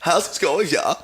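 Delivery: high, lilting mock German accent; eccentric camp German accent. Showing off a niche accent, high camp German accent. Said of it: "homosexual German accent".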